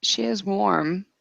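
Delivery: ordinary talk, nothing marked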